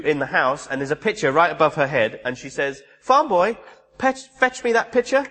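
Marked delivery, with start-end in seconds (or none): 3.0-5.2 high, imperious order to a servant; bossy princess. Retold or imitated. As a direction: boss the farm boy around, a little haughty. Buttercup orders the farm boy.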